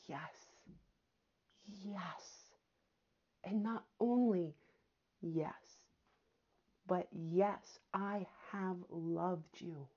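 very soft, slow and tender; tender hush. Real, not acted. Preacher telling doubting listeners God loves them, hushed and tender. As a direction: lay it down gently like a reassurance.

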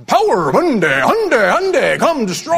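booming hyped arena announcer shout; monster truck announcer. Retold or imitated. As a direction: sell it like a truck rally ad. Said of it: "but it's this real deep".

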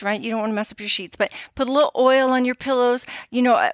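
usual speaking voice

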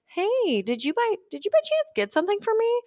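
high, sugary checking in; gently fishing for an answer, voice up high; sweet hesitant ask. Retold or imitated. Texting a friend about the gift, high sugary ask.

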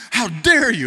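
high, loud huffy outrage; indignant retort. Retold or imitated. Shocked woman snapping at the honking driver, loud indignant. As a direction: snap it back like an offended shopper.

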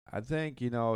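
ordinary talk, nothing marked

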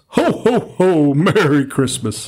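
booming high jolly Santa laugh. Shown as a demonstration. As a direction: belt out a big slow ho ho ho. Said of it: "Santa says".

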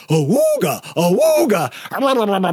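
cartoon horn hoot, then babble; silly imitated awooga with rubbery gibbering; cartoon sound effects. Shown as a demonstration. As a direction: honk it like an old cartoon. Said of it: "I steal sound effects from all the cartoons".

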